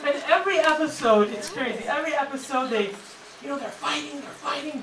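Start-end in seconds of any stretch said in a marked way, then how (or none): none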